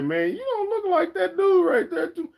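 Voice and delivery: high squeaky mock voice through a laugh; mocking viewers. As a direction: laugh while voicing doubters. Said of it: "I know y'all say".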